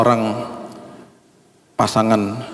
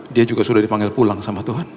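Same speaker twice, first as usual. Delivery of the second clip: ordinary talk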